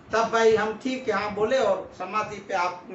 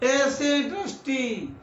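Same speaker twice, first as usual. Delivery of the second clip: high, drawn-out jibe at them; scornful exclamation; 6 semitones higher, slower than usual. Real, not acted. Sarcastic jibe at the trustees. (तंज़ भरे ऊँचे, खिंचे स्वर में)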